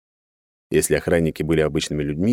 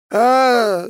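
high, loud scream; long high cry of pain; 6 dB louder than usual. A narrator's reading. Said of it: "заорал бандит и отключился". (истошный вопль бандита)

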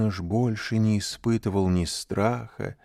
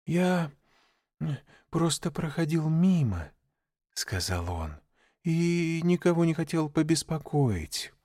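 put-on higher, nervous child voice; a small boy trying to sound calm; boy's voice; 9 semitones higher than usual. A narrator's reading. Frightened boy answers snake.

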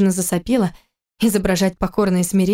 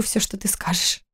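whispered; 7 dB quieter than usual. A narrator's reading. Kira feigning meek submission.

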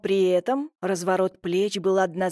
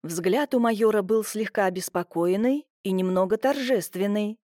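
ordinary delivery

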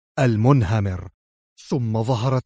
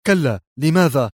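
mildly tense, raised answer; uneasy, slightly raised questioning; tense reply; 2 semitones higher, 4 dB louder than usual. A narrator's reading. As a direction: answer with a touch of tension. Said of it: "توتر صوت جنرال المخابرات الروسي".

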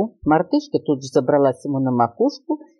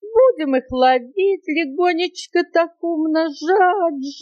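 high, slow, sing-out monkey voice; gleeful scheming monkey; 9 semitones higher, slower than usual. Acted. Monkey explaining her robbery as mathematics, gleeful. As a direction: stretch each step of the plan out with relish.

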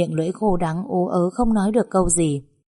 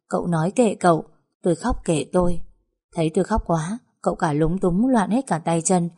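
plain reading voice

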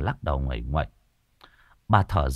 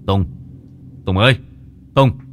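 calling out; 7 dB louder than usual. Acted. Calling friend loudly.

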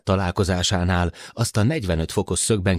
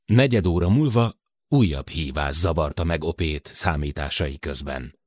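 usual speaking voice

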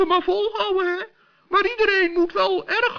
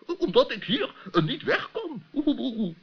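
low, halting professor voice full of ehs; an absent-minded old scholar fumbling for words; low hesitant professor stammering; doddering professor; 13 semitones lower than usual. Acted. Professor voice throughout.

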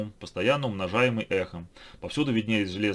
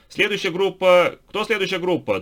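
loud shouting; 10 semitones higher, 8 dB louder than usual. A narrator's reading. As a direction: shout for the next act. Calling from the doorway.

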